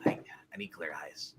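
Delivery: breathy stage whisper. Pretend. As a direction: hush it like a secret. Whispered aside.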